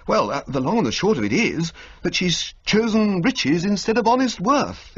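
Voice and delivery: put-on excited young toff. Bertie to Jeeves about the cook, high excited toff voice. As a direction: spill the gossip.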